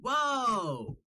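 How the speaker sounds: high, drawn-out mock amazement; astonished. A Bostonian reacts to being asked if they're from Boston, astonished. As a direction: play a Bostonian blown away by the guess.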